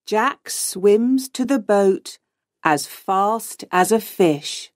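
plain reading voice

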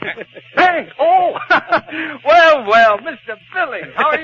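light up at the word bank and gush; a greedy man suddenly all charm; overjoyed. Acted. Sudden delight on hearing of the bank.

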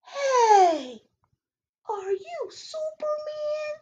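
high, hushed wonder; amazed boy. Acted. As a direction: ask it wide-eyed and awed. Sydney amazed.

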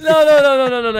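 loud laughing no no no; laughing protest. Real, not acted. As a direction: shout it down through laughter.

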